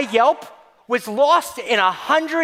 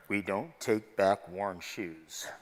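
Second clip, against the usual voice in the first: much lower and slow, flat refusal of a snooty clerk; deep clerk voice; 14 dB quieter, slower than usual. Retold or imitated. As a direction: drop low and slow like a stiff store clerk. Voicing the clerk again.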